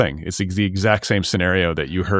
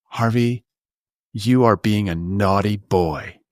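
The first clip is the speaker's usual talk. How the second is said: mommy voice. Retold or imitated. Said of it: "the mommy voice".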